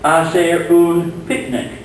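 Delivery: slow, careful Spanish for learners; slow Spanish phrase. Shown as a demonstration. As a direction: model the phrase slowly. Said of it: "We have, for example".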